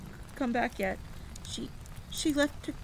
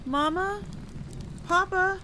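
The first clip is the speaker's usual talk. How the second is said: high, loud searching calls; calling out; 13 semitones higher, 7 dB louder, slower than usual. Acted. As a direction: shout for both parents, voice rising. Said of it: "I called out".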